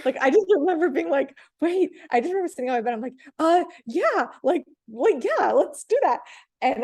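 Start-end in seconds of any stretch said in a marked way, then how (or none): 1.6-1.9 high, loud burst of disbelief; excited. Retold or imitated. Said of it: "I was so excited". 3.4-6.2 high, loud, eager yes; can't hide the thrill; excited. Retold or imitated. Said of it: "I was so excited".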